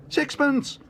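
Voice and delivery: put-on very high, loud young bear cry; eager bid. A narrator's reading. Paddington raising his own bid, crying out eagerly. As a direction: squeak the new bid out loudly.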